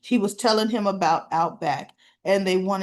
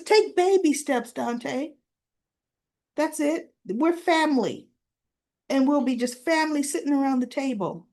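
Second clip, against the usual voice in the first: higher-pitched retold pep talk; Christina's coaxing advice; 5 semitones higher than usual. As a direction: coax him in a raised, insistent voice. Retold Christina coaching Dante.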